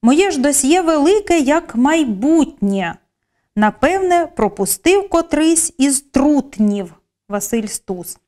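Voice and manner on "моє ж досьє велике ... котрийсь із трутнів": slow, expressive verse reading; poem recital. Acted. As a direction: linger on each phrase like a poem. Teacher reads Stus poem example.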